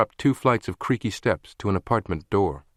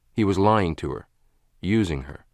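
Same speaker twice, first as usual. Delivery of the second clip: normal talking voice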